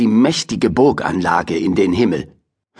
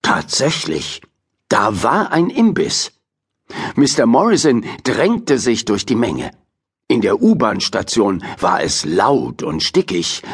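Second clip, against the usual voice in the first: usual speaking voice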